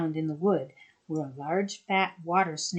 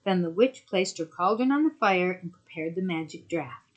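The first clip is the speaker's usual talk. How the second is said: usual speaking voice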